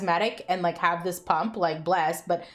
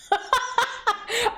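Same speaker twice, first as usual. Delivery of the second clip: a high burst of laughter; real laugh; 14 semitones higher than usual. Real, not acted. Vlogger laughing at her own hangover story, real laugh.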